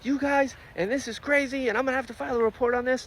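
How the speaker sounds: a flustered officer chewing them out; lightly hammed-up exasperated scolding; mock yelling constable. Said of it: "yelling at Wax".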